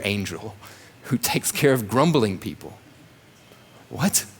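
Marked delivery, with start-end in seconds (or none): 3.9-4.2 mock surprise. Mock shock at destroyer angel.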